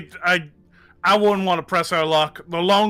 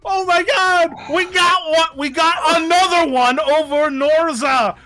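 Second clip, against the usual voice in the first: high, loud triumphant yells; excited shouting; 9 semitones higher, 3 dB louder than usual. Real, not acted. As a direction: yell it out in pure victory. Players celebrating a win.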